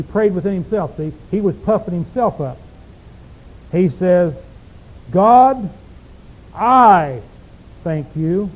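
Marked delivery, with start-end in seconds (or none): 5.1-8.5 I punched louder and held apart; God, pause, then a loud I before thank you; stress on I. Shown as a demonstration. Preacher acting the proud Pharisee, stressing I. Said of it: "Did you hear what word I emphasized?".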